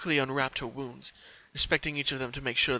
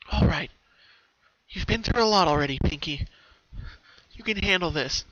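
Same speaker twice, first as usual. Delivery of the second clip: put-on higher, louder, fast girlish voice; pony motivates herself before going out, determined; pep talk to herself; 5 semitones higher, 4 dB louder than usual. A narrator's reading. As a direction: hype yourself up. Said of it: "she softly motivated herself".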